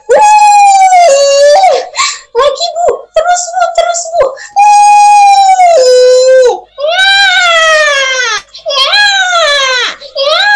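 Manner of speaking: very high, loud encouraging cries; urging the mother. Acted. Midwife urges the mother during birth, high excited cries. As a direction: push her on through labour.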